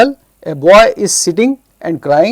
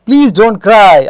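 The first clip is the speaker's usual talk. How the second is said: loud, higher plea from a lightly voiced fox; storybook plea; 5 semitones higher, 9 dB louder, much slower than usual. Fox urges the crying friends to stop, in a loud pleading voice. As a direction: project the comfort emphatically.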